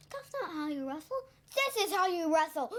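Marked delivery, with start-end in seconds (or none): 1.5-2.7 raised voice breaking into a high cry; hammed-up taunt. Child acts out Billy wrestling, raised hammed-up taunt. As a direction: act it big, ending on a gasped yell. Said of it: "and then Billy says".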